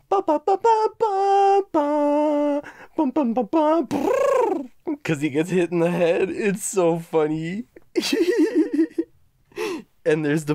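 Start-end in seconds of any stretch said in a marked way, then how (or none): 0.1-4.7 high, loud sung trumpet toots ending in a crash; mock trumpet fanfare. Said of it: "the dude comes out with the trumpet and he's like". 7.7-9.0 high giggle. Real, not acted. Laughing at the movie scene.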